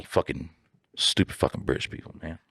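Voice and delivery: muttered under breath. Real, not acted. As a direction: mutter it to yourself, nearly voiceless.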